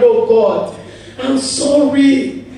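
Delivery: loud, slow mock wail with a laugh; hammed-up child crying to God. Preacher imitating himself as a child wailing, mock crying. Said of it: "As I was crying".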